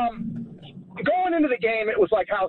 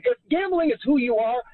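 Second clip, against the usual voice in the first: ordinary talk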